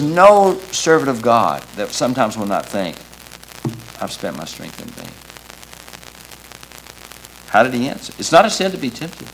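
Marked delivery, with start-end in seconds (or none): 4.0-5.1 low, hushed admission; soft, low voicing of a weary thought; discouraged inner thought. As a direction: drop right down, as if thinking it privately. Voices a servant's private discouraged thought.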